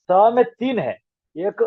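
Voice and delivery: ordinary talk, nothing marked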